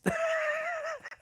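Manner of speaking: a sudden high-pitched laugh; real laugh at his own story. Real, not acted.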